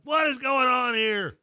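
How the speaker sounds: fake-curse the network while tearing up; mock exasperation. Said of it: "I'm like".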